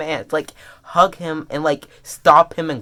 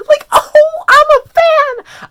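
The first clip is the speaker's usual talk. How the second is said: high, loud, drawn-out wail; stretched high-pitched squeal; overwhelmed fan; 9 dB louder, much slower than usual. Real, not acted. Overwhelmed by the chapter's emotion.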